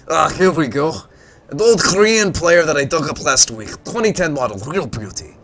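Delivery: put-on a well-meaning old salvager, hamming it up; gruff old tinkerer. Old salvager introduces himself to the visitor, gruff and friendly.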